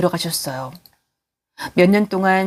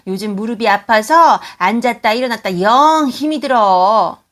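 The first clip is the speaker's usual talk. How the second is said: loud, higher, ordering her around; bossy demanding; 5 semitones higher, 5 dB louder, slower than usual. Acted. Mother-in-law ordering her sick daughter-in-law to fetch water. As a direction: bark the order down the hall, then whine. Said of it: "함부로 대하기 시작했어요".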